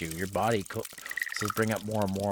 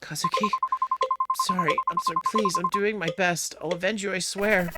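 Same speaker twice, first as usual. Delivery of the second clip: high-pitched, urgent apology; anguished, high plea; desperate pleading; 9 semitones higher than usual. Acted. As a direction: beg frantically not to be hated. Begging a dead partner's forgiveness.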